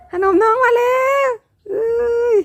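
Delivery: high, slow cooing call; high sing-song to dogs. Acted. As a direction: coo it slowly up high like calling pets. Calling dogs to snacks.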